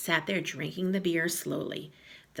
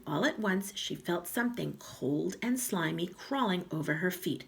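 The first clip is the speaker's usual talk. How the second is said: ordinary delivery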